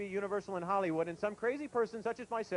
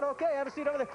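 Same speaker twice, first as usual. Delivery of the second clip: ordinary delivery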